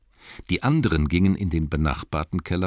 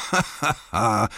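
higher laughing elf voice; mocking laugh; 6 semitones higher than usual. Elf laughing at the drunk cellarman. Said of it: "rief einer".

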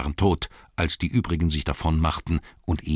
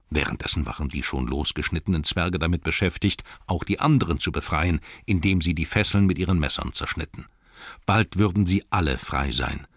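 ordinary delivery

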